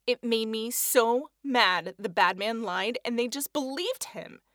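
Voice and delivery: plain reading voice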